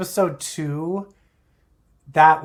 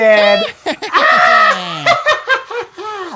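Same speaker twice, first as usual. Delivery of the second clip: loud laughing shout; 13 semitones higher, 8 dB louder than usual. Real, not acted. Big laugh.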